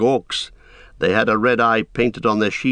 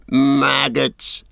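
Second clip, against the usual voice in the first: jeering; 5 semitones higher than usual. A narrator's reading. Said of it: "jeered the Isengarders".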